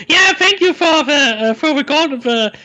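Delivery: put-on high, loud shrill old woman voice; Terry Jones mother voice. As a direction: squawk it like a pantomime mum. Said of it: "the mother, played by Terry Jones, is like".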